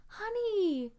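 high, tender honey; moved, tender thanks; touched. Real, not acted. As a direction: melt at the gift. Touched by surprise ornaments.

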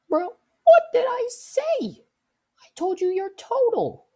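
very high, loud incredulous squeal; exasperated squeal. Acted. Cashier venting about customer's total, exasperated squeal. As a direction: shriek it in sheer disbelief.

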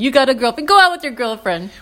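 mock tough guy. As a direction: lean on him like a Jersey tough. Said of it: "he's like".

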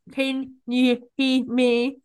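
slow, word-by-word hearing check; slow, cut-up words. Shown as a demonstration. Hearing person checking on a deaf student, exaggeratedly slow. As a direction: break it up word by word, slowly. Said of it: "cut it up slowly".

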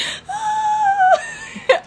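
a high wailing laugh-cry, half sob and half laugh. Retold or imitated. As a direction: let a squealing laugh break into a sob. Retelling sobbing at the film.

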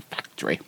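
"Factory" squashed to FAC-tree, softly; imitated pronunciation. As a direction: mimic her "Factory", quietly. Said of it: "another one, she was like".

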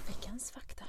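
breathy announcer whisper; whispering the segment title as a mock jingle. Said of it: "Vi borde göra en jingle".

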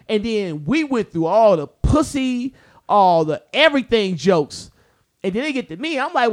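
ordinary talk

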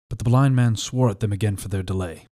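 ordinary delivery

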